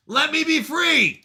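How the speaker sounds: high, drawn-out plea for freedom; mock pleading cry. Reading chat as the captive in his walls, mock pleading cry. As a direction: wail it like a hammed-up prisoner.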